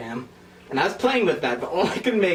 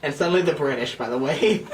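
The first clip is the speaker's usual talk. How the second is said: normal talking voice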